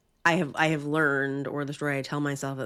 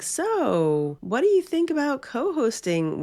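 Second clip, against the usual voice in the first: tentative asking; 11 semitones higher, 4 dB louder, slower than usual. Retold or imitated. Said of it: "I was kinda tentative".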